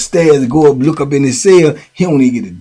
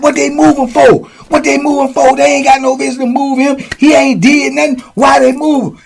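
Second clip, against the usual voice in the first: outraged; 12 semitones higher, 3 dB louder than usual. Retold or imitated. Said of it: "He was like".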